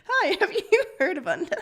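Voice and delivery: hammed-up religious greeting through laughter; mock door-to-door evangelist. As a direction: sell Unda like a preacher at the door. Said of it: "like, a religious person".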